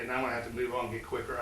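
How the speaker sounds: rushing the words out. Real, not acted. Speaker racing the clock on his story, talking fast. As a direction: hurry it along, clipping through. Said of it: "I know, start talking faster".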